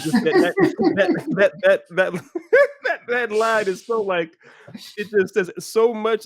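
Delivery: high, loud laughter through the words; cracking up while talking; laughing. Real, not acted. Laughing at a favorite line.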